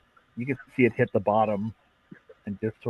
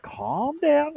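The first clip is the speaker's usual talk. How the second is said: much higher, placating squeak; nervous backpedal; slower than usual. Acted. Mechanic with a blade at his throat: high placating backpedal.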